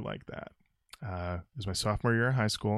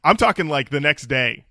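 normal talking voice

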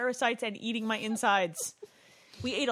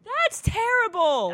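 high, loud, drawn-out disgust; appalled outcry; 10 semitones higher, 7 dB louder, slower than usual. Real, not acted. Host hears the colour is added on purpose, appalled. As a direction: cry out slowly, appalled.

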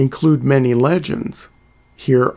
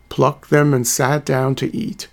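ordinary talk, nothing marked

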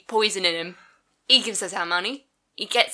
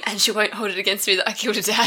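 ordinary delivery

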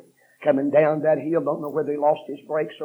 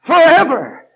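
high, loud, drawn-out cry; shouted preaching; 15 dB louder, slower than usual. Acted. Preacher shouting about eternal hell, high and loud. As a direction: hurl the word at them.

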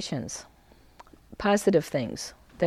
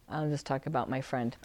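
usual speaking voice

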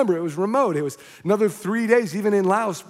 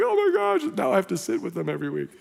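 mock dismay; much faster than usual. Preacher jokingly voicing a friend stuck with guests, mock dismay.

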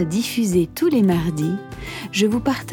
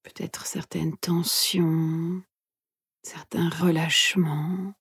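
soft, hushed meditation guide; guided meditation whisper; 5 dB quieter than usual. Acted. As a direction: whisper gently, leaving long pauses.

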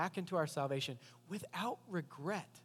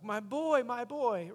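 raised, louder anguished cry of a parent; louder heartbroken call of a parent over his lost boy; grieving cry; 7 semitones higher, 6 dB louder, slower than usual. Retold or imitated. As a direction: cry out the loss like a bereaved father. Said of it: "his parents are like".

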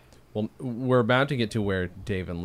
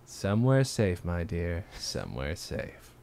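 acted sly reply; 3 semitones lower, slower than usual. Host acting Bill's reply about the hidden license, slow and sinking. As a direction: draw it out, then let the repeat drop away.

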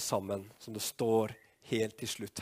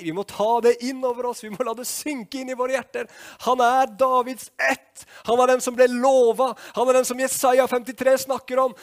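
high, loud, insistent sermon voice; impassioned preaching; 13 semitones higher, 10 dB louder than usual. Acted. Preacher urging the congregation to take it to heart, impassioned preaching. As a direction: drive it home at full force.

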